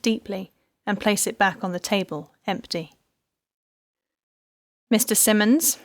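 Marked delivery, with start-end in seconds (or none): 4.9-5.8 authoritative. A narrator's reading. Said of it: "Isabel said authoritatively".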